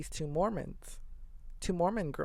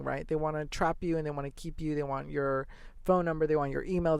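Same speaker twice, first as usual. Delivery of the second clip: ordinary delivery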